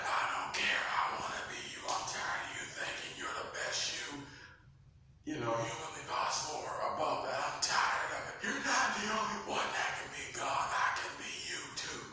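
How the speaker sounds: high, hushed, fast grumble; whispered Lucifer. Acted. Lucifer grumbling to God, high hushed voice. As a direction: hiss out the envy.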